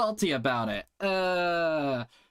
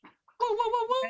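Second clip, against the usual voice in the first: much higher hype-up whoas for the crowd; excited whoa. Real, not acted. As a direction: rev up the audience after the set. Host hypes the crowd after set.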